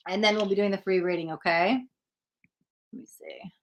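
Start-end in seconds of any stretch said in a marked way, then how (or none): 2.9-3.5 barely audible whisper to self; very soft unvoiced mumble; whispered aside. Real, not acted. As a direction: whisper it to yourself while checking. Muttering to self while checking.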